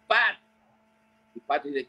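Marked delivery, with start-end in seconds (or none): 0.1-0.4 high shouted call of a name. Retold or imitated. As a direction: yell across the water to get his attention. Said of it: "Empieza a gritar". (llamada a gritos, aguda y fuerte)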